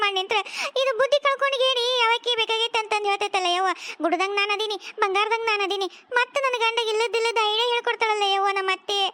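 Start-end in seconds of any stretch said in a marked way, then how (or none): none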